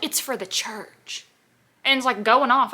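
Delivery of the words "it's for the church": slow, softer mock-sanctimonious line; mock pious customer. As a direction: drag it out like a self-righteous church lady, lightly mocking. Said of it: "And then she was like".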